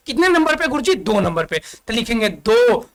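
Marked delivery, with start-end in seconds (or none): 0.1-0.9 high, eager student voice asking sir; student asking teacher. Pretend. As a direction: play a pupil putting up a question. (गुरुजी से पूछता छात्र, ऊँची आवाज़ में)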